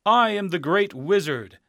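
high, loud proclamation from above; the hidden wizard booming at the visitors. Acted. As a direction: announce yourself grandly. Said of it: "said a voice high above them".

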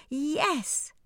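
high, joyful cry; excited yes. Acted. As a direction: burst out with joy. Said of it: "cried Cinderella".

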